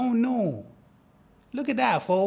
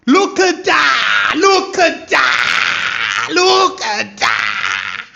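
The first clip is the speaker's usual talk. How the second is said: slow, loud, higher shouted triumph; excited shout; 4 semitones higher, 12 dB louder, much slower than usual. Real, not acted. Trader shouting to viewers as price reacts, triumphant. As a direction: bellow it three times, drawn out.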